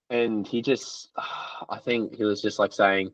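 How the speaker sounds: normal talking voice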